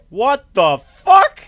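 slow, loud, word by word; shocked exclamation. Real, not acted. Player shocked by the game, slow loud disbelief.

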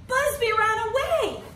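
very high alarmed cry; children crying out. Acted. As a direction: kids rushing home with news. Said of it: "they cried".

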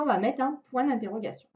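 ordinary delivery

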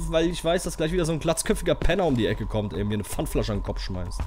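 normal talking voice

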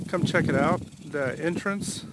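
ordinary talk

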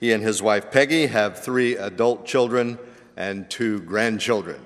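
normal talking voice

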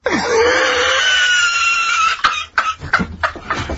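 high, screaming burst of laughter; shrieking laugh. Real, not acted. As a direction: let out a howling laugh. [name] gives up mid-burger.

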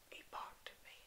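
alarmed whisper; hushed startled whisper. Real, not acted. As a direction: whisper it in disbelief. Mob barks at him.

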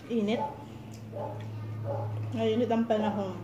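speaking between chewing motions; words muffled by food; mouth full, chewing. Real, not acted. Seen 0: Lips pressed, cheeks working after bites; she eats throughout.

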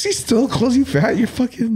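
high-pitched, drawn-out disbelief; way up high, appalled for a friend; incredulous. Real, not acted. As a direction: squeal it in disbelief. Mock outrage at friend's dad.

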